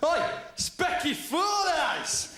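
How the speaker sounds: high, slow jeering yell of "Oi, specky four eyes"; hammed-up playground taunt; mock bully shout. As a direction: jeer it down the corridor like a school bully. Bullies shouting in corridor.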